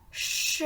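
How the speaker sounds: "ch" as a voiceless shush on its own; isolated CH sound. Shown as a demonstration. Teacher asking which letters make it, "ch" as a shush. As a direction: sound out "ch" as one sustained hiss. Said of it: "les lettres qui font le son".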